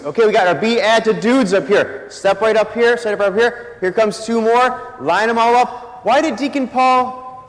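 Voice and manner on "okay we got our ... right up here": normal talking voice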